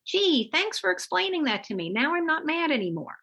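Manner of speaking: sarcastic, hammed-up thank-you; sarcastic child. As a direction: play a sudden cheery child, tongue in cheek. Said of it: "and the child says".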